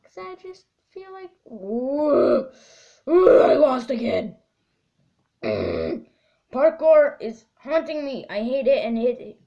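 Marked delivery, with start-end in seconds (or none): 1.5-4.4 loud frustrated groan. Real, not acted. Losing the parkour. 6.5-9.4 frustrated rant. Real, not acted. Ranting about parkour.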